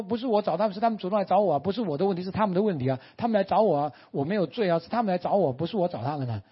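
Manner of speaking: high-pitched, protesting self-defence; mock defensive husband. Husband blaming others to his wife, defensive. Husband's excuses in acted quarrel.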